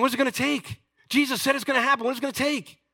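high, loud exasperated demand; exasperated pleading. Acted. Disciples pressing doubting Thomas, exasperated and loud.

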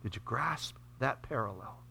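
hushed whispered question. Real, not acted. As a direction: lean in and whisper it. Pastor drops to hushed question.

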